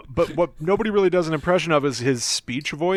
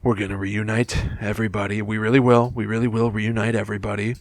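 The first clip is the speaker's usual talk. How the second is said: Trump Oval Office voice; 5 semitones lower than usual. Retold or imitated. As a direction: read it flat like you don't believe it. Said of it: "addressing America from the Oval Office voice".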